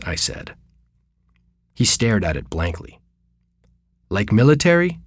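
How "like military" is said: put-on old barber asking in a light French accent, higher pitch; a hint of a French-accented question; lightly French-accented, puzzled. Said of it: "he said in his French accent".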